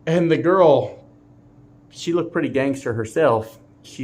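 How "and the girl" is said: ordinary talk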